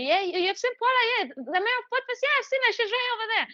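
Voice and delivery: high, bubbly mock announcement; excited hype. Adam telling everybody she is mayor, high and bubbly. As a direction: pitch it up like a gushing friend. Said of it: "was telling everybody".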